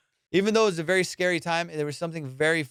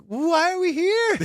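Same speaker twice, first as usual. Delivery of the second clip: very high, drawn-out question; bewildered; 4 dB louder, slower than usual. Retold or imitated. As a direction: imitate her slow, baffled asking. Imitates confused girlfriend.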